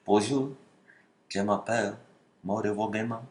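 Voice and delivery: a light, soft put-on French accent; hammed-up French accent for the viewers; mock French accent. Showing off a mock French accent to French viewers. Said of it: "How good is my French accent?".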